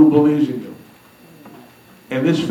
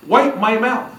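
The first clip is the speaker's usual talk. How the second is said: high-pitched imitation of a bossy woman; mock command; 6 semitones higher, slower than usual. Mary ordering Henry at table, high mock command. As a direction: order a servant about in a higher voice.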